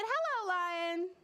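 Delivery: high, drawn-out hello; boy's greeting. Acted. Boy in story greeting a lion, high slow hello. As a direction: greet slowly on a high note.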